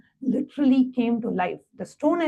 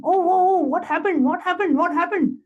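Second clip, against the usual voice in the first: retold shouting; 7 semitones higher than usual. Disciple retelling his shock as the boulder rolls, mock shouting. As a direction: imitate panicked shouting, pitched well up. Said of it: "I started shouting".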